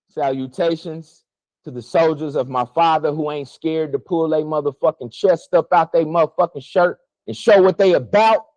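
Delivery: usual speaking voice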